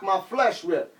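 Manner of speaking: usual speaking voice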